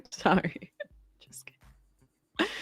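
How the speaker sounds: breathy whispered apology; whispering. Real, not acted. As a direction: whisper it as a joke. Whispered aside.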